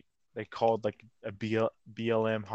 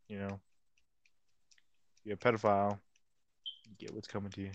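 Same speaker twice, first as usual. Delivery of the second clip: usual speaking voice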